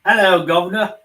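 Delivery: loud, high mock cockney hello; hammed-up cockney accent. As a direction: bellow a hammed-up cockney greeting.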